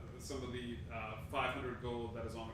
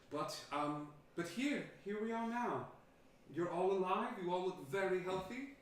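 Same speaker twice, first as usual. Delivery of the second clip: much higher, louder sing-song royal voice; regal queen greeting; 6 semitones higher, 3 dB louder than usual. Acted. Red Queen greeting the townsfolk grandly. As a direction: sweep in like a gracious queen.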